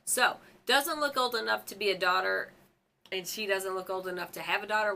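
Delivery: usual speaking voice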